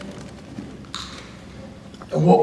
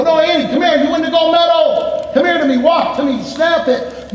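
higher, calling across the field; coach calling out; 9 semitones higher than usual. Retold or imitated. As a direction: call the girl over from far off. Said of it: "I go".

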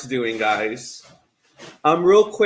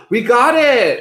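excited; 9 semitones higher, 4 dB louder, slower than usual. Real, not acted. Finishing a hard challenge.

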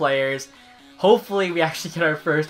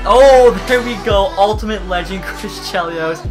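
excited yelling; 5 semitones higher, 10 dB louder than usual. Real, not acted. Big pull after long bad luck.

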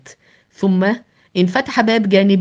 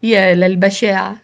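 plain reading voice

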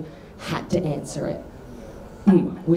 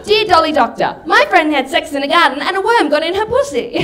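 high, loud schoolgirl letter voice; mock letter writer; 10 semitones higher, 6 dB louder than usual. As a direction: read it out as an over-earnest, high-pitched plea. Reads out her childhood prank letters.

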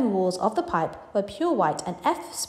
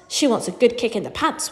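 ordinary delivery

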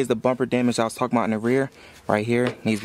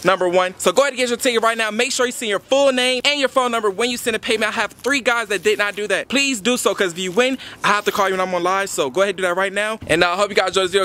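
hyped promo pitch; 8 semitones higher, 6 dB louder than usual. Acted. Pitching raffle tickets to viewers.

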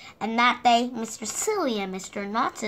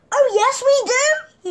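much higher, louder triumphant cry; 13 semitones higher, 10 dB louder than usual. Acted. Mister Silly answering with a sudden idea, high loud cry. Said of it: "he cried".

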